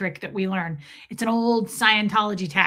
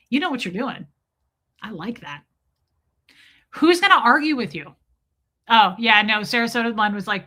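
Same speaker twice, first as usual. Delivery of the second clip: normal talking voice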